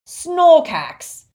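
very loud, drawn-out single word; slow, furious bellow; angry roar. A narrator's reading. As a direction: bellow it slowly with contempt. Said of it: "roared the Death Eater".

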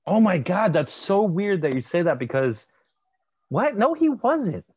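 high, loud burst of disbelief; incredulous. Real, not acted. Host disputes his cohost's film fact, incredulous. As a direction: leap up in pitch as you object.

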